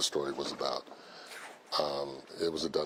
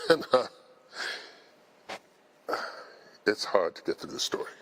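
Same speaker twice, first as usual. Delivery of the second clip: getting emotional; 5 semitones higher than usual. Real, not acted. Speaker apologizing to the audience for getting emotional, choked up. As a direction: overcome with real emotion, catching mid-sentence. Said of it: "I'm getting emotional about this".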